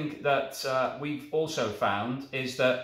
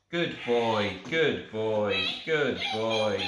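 praising a pet; 4 semitones lower, much slower than usual. Pretend. Praising toy dog.

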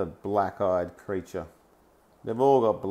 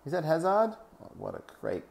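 normal talking voice

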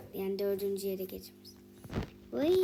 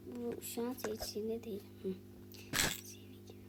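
whisper; 7 dB quieter than usual. Real, not acted. Quiet aside during play.

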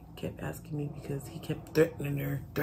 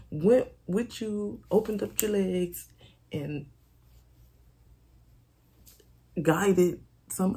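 loud, higher-pitched defensive comeback; indignant retort; 5 semitones higher, 5 dB louder than usual. Retold or imitated. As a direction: throw the blame back forcefully, voice raised. Arguing back at angry friend.

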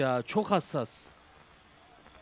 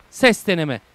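mic test; 3 semitones higher, 11 dB louder, slower than usual. Real, not acted.

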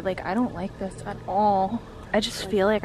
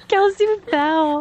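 excited laughing; 11 semitones higher, slower than usual. Real, not acted. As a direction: call it out through laughter. Laughing at friend's fall.

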